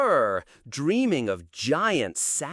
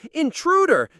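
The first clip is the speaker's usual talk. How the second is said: alarmed shout; 11 semitones higher, 5 dB louder than usual. Acted.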